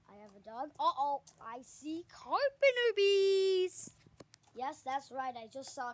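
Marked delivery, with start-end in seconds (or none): 0.8-3.9 loud, slow alarmed call; startled and loud, drawn out. Real, not acted. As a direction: sound the alarm on spotting the bees. Spots bees, alarmed.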